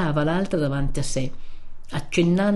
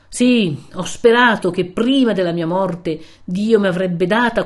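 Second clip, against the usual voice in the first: stern friar preaching a rebuke, rising louder at the peaks; wrathful old friar; 3 dB louder than usual. Acted. Narrator voices the friar's long rebuke. (vecchio frate severo che rimprovera)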